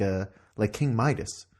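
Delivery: usual speaking voice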